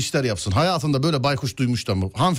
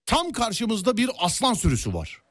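tour guide voice; 4 semitones higher than usual. Acted. Playing a safari tour guide.

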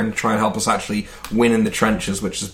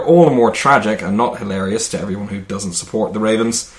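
normal talking voice

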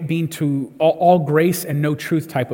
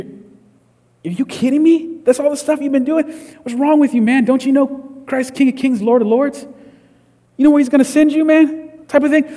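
much higher, sneering put-on scold; mocking harsh judge; 9 semitones higher, 3 dB louder than usual. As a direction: lampoon the graceless truth-teller, pitched way up. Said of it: "It's like".

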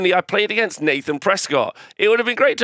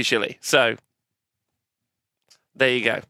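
ordinary delivery

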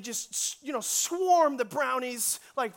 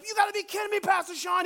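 high-pitched shouted disbelief; mock-outraged teenager protesting; imitated yelling; 9 semitones higher, 4 dB louder, faster than usual. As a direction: yell it high, incredulous. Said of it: "they're yelling at him".

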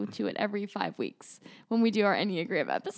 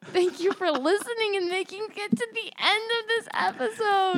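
higher-pitched podcast farewell; high-pitched sign-off; 10 semitones higher than usual. Real, not acted. Host thanking listeners at episode's end, high-pitched sign-off. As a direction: lift the pitch well up for the outro.

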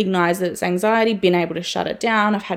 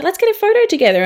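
imitating a friend, much higher, louder and fast; eager friend; 12 semitones higher, 5 dB louder, faster than usual. Friend at the event invites her into a photo, imitated as high, loud and eager.

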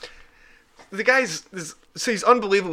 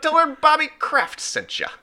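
put-on old-timey voice; 13 semitones higher than usual. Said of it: "old-timey voice".

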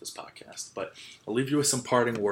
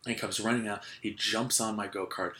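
usual speaking voice

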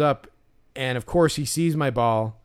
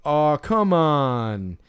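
long, drawn-out, louder whine of protest; imitated pleading; 3 semitones higher, 5 dB louder, much slower than usual. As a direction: imitate someone begging, stretching every word out. Acting out a pushy fan's pleading.

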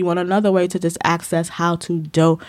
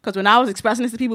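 ordinary talk, nothing marked

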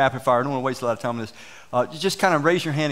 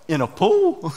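incredulous laughing question; laughing disbelief; 3 semitones higher, 4 dB louder, slower than usual. Retold or imitated. Pastor retelling elder's thought with a laugh.

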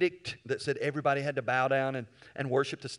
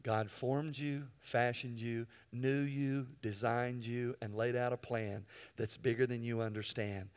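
tender altar call; 4 semitones lower, 7 dB quieter than usual. Real, not acted. Pastor inviting heads bowed, softly tender. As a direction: draw them in quietly.

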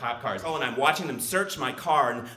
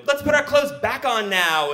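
eager suggestion; 10 semitones higher, 6 dB louder than usual. Retold or imitated. Retold plan after awkward drive.

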